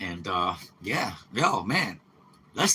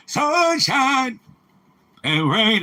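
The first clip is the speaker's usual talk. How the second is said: put-on high, thin old man voice; 10 semitones higher, much slower than usual. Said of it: "That's my old man voice".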